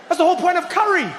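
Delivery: fast comic exasperation; ranting shout. Acted. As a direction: hammer the point home. Ranting about spice.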